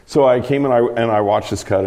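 normal talking voice

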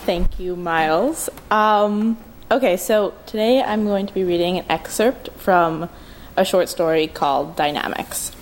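usual speaking voice

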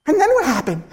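high, loud mock suspense; comically gripped, laughing outburst; eager listener. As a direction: ask it as if hanging on the story. Retold eager listener to own story.

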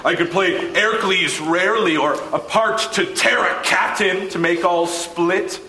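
ordinary delivery

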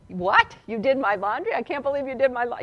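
shocked, rising mock disbelief; mock outrage. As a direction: act out the stunned reaction. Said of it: "that was my reaction".

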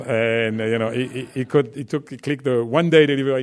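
normal talking voice

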